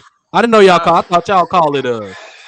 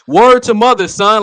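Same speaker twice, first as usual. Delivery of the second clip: East Coast imitation; 5 semitones higher, 3 dB louder than usual. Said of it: "everybody in the East Coast be like".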